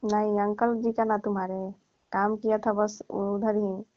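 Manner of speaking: usual speaking voice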